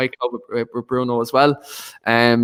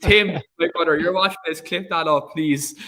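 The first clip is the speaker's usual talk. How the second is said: higher voice, breaking into laughter; real laughter; 5 semitones higher than usual. Real, not acted. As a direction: plead with your brother while cracking up. Cracking up after his impression.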